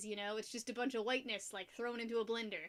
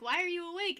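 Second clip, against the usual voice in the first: high, loud, baffled question; raised voice of mock bafflement; retold puzzled question; 7 semitones higher, 6 dB louder than usual. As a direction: ask it in acted surprise. Retells own puzzled question to friend.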